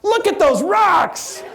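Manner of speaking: high, slow, excited pointing; hammed-up skipper. Skipper pointing guests at rocks, high and slow. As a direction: draw the crowd away from the rhino. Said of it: "and you're going".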